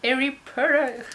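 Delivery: slow, loud, hammed-up English accent; bad English accent. Showing viewers her Harry Potter bookmark, terrible English accent. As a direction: badly fake an English accent, stretched out. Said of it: "avec mon accent pourrave".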